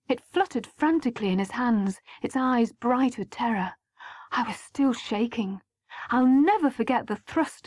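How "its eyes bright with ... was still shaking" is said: ordinary delivery, nothing marked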